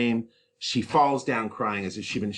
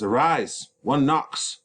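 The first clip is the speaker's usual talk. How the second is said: friar's voice; 6 semitones higher, 4 dB louder than usual. Acted. Teacher reads the friar's lines.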